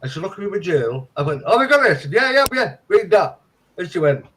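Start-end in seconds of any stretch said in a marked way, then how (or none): 1.5-3.4 high and loud, eager; excited. Retold or imitated. Claimant eggs on the adviser, high and excited. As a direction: gush it, all eager. Retold own excited reply.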